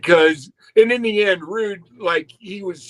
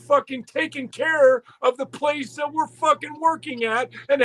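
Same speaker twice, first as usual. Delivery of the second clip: imitated boss rant; 7 semitones higher than usual. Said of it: "fucking yelling, screaming at Knobs and Sags".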